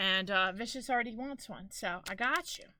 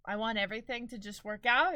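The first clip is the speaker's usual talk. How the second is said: usual speaking voice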